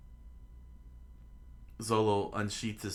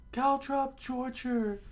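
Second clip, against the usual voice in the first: loud, slow, very high falsetto; high cartoon battle cry; attack call; 5 dB louder, slower than usual. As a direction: squeal the attack name, drawn out. Attack name called out in story.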